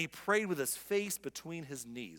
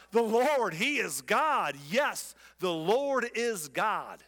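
loud, drawn-out proclamation; crying out; 4 semitones higher, 4 dB louder, much slower than usual. Acted. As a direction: cry it out slowly with big stressed words. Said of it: "they cried out".

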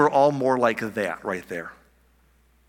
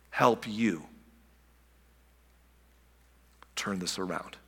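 soft, tender concern, fading quieter; gentle loving God; 2 semitones lower, 3 dB quieter than usual. Retold or imitated. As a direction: plead gently like a caring parent. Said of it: "That's not the tone at all".

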